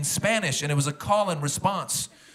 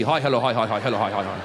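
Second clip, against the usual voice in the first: bow lower each time you greet; descending mock greeting; 7 semitones lower than usual. Bowing greeting bit.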